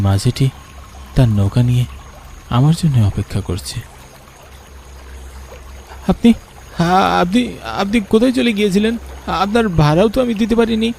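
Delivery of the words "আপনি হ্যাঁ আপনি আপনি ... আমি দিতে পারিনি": put-on higher boyish voice, eager and surprised; a boy hailing the boatman he recognises; young boy calling. (উঁচু গলায় অবাক বালকের ডাক)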